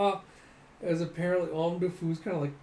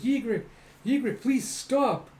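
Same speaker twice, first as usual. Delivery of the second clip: put-on high, louder pleading girl's voice; 9 semitones higher, 5 dB louder, slower than usual. Girl begging the fighter to stop, high pleading. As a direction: beg him to stop, voice up high.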